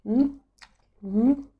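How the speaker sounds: slurping noodles while humming; muffled chewing mid-bite; mouth full of noodles. Real, not acted. Seen 3: Noodles hang from lips, chopsticks at mouth during the hum.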